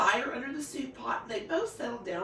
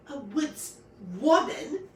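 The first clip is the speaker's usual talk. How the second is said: sniffy; 4 semitones higher, 6 dB louder, slower than usual. A narrator's reading.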